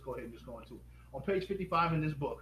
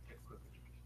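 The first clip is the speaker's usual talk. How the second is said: breathy whispered aside, much softer; 17 dB quieter, faster than usual. Real, not acted. Host whispering to viewers to snap the book, a quick whispered aside.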